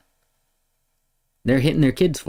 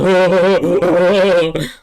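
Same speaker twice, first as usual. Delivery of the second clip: scornful laugh; 8 semitones higher, 7 dB louder than usual. Real, not acted. Laughing at his own mockery.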